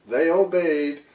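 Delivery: usual speaking voice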